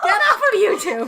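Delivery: loud laughing shout. Real, not acted. Laughing louder at music.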